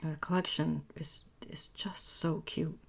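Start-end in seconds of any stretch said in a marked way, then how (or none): none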